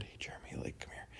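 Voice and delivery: breathy whisper calling someone over. Retold or imitated. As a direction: hush it across the room. Sacristan calling him over quietly.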